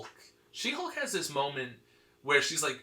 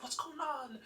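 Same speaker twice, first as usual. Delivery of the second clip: mock whine; 12 semitones higher, 8 dB quieter than usual. Heroes react to She-Hulk attacking.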